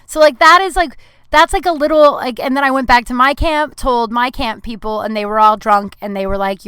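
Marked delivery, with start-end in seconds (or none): none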